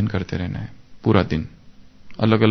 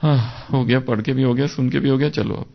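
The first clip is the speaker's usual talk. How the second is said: fast, rushing through the text; mock hurried reading. Shown as a demonstration. Teacher mocking students who rush the reading, hurried. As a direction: rattle it off to get it done. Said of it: "ऐसे नहीं पढ़ लेना".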